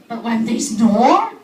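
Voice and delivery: loud and slow. Retold or imitated. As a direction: boom it across the room. Describing loud snoring.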